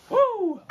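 loud high whoop; woo cheer. Real, not acted. Cheering sign-off.

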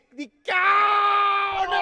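high, loud anguished cry; shout. Real, not acted. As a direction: yell at the missed chance. Near miss shout.

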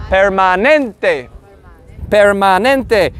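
slow, low permanente with open A; open-mouthed "ah" in permanente; dentist "ah". Shown as a demonstration. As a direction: model permanente in Spanish, stretching the "ah". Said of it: "the A is like at the dentist".

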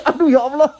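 giggling high yelp of mock pain; laughing. Real, not acted. Laughing under baby's feet.

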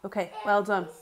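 ordinary talk

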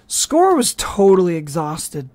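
plain reading voice

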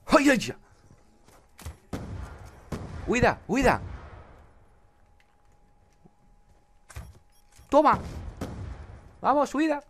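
high, loud frantic cries; panicked yelling. Real, not acted. Gamer shrieking to flee under attack. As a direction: scream to retreat in a panic.

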